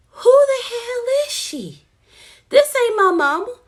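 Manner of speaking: high, loud shocked cry of this ain't my mama; shocked disbelief. Retold or imitated. Chloe realizes the woman is not her mother, high shocked cry. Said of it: "She like".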